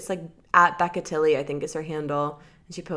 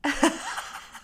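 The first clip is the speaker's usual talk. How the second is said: airy high laugh; breathy laugh; 11 semitones higher than usual. Real, not acted. Laughing at joke.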